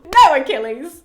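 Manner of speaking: loud, high burst of disbelief; playful yelled scolding; exasperated cry. Acted. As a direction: call out to stop a foolish hero. Teller scolds character in exasperation.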